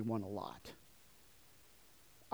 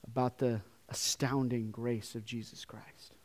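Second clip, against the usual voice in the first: whisper; 3 semitones lower, 7 dB quieter, slower than usual. Real, not acted. Hushed over God's grace.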